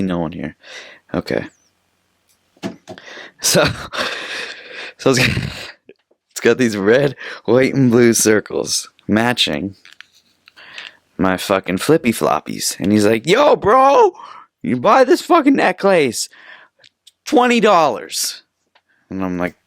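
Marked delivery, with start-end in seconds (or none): 3.7-4.5 short breathy, voiceless chuckle; soft laugh escaping mid-thought; real stifled giggle. Real, not acted. Breaks off mid-description, amused. 13.3-18.4 high-pitched, hammed-up hawker's call; mock a beach hustler calling out loudly; put-on high vendor voice, stretching the price; pushy vendor imitation.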